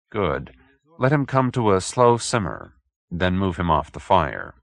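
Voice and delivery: ordinary delivery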